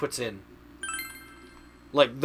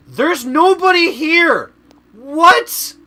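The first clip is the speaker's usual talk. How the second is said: high, loud, slow baffled exclamation; loud surprise; 13 dB louder, much slower than usual. Real, not acted. Gamer finding the shop empty, yelling in loud disbelief. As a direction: shout it like an empty room shocked you.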